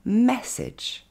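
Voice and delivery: MESSAGE as "MESS-edge", MESS high and punched; stress on first syllable. Shown as a demonstration. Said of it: "L'accent tonique tombe sur le mais".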